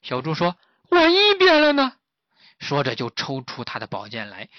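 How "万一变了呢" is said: high, loud fretting squeak; worried pig. Acted. Pig fretting to master that he might change, high and anxious. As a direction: panic about changing.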